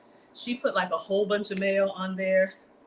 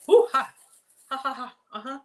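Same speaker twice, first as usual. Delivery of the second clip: hot food gasp; 5 semitones higher, 6 dB louder, faster than usual. Real, not acted. Tasting a hot fry.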